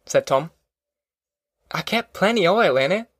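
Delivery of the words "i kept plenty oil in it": higher-pitched, defensive protest; aggrieved, pitched-up grumble; complaining. A narrator's reading. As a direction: complain as if you're being blamed. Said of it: "Al complained".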